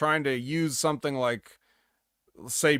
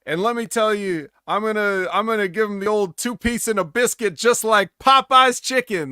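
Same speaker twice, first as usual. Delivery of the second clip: loud, higher-pitched mock promo that swells to a bellow and slows on the last line; hammed-up wrestling promo; 8 semitones higher, 7 dB louder than usual. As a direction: ham it up like a wrestler cutting a crowd promo. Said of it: "and he's like".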